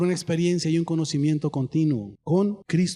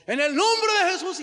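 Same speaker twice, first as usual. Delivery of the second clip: high, shouting rebuke; over-the-top exorcism cry; mock shouted prayer; 12 semitones higher than usual. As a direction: yell it into her ear. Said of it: "y le gritaba al oído".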